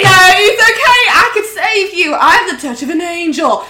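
high, loud wailing insistence; overwrought rescuer. Acted. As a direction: plead shrilly to save them. Sandra insists on saving him.

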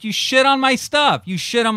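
high, loud, outraged shout at the pranksters; furious yell. Retold or imitated. Retelling the prank, a furious high yell. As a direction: yell it like your stuff was just defiled. Angry retelling of finding shit.

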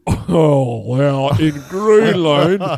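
ordinary talk, nothing marked

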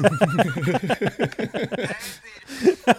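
high, loud burst of laughter. Real, not acted. Big laugh after silly caller.

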